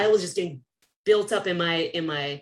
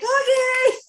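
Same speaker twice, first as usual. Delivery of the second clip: squeaky okay; 7 dB louder than usual. Retold or imitated. Agreeing before passing out.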